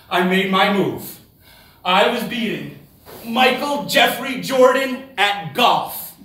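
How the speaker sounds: growing excitement; building triumph. Real, not acted. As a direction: relish every syllable of his name.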